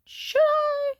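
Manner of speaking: loud, very high-pitched questioning squeal; exaggerated doubt. Repeats question, exaggerated second take.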